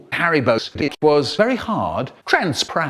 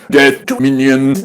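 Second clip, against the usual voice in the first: mock poet voice; 7 dB louder than usual. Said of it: "muck about doing the voices".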